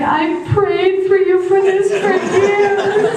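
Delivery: high, slow, drawn-out happy sobbing voice; hammed-up weepy old lady; mock tearful wail. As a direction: imitate a crying grandmother, climbing in pitch. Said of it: "and she's crying".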